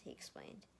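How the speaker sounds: plain reading voice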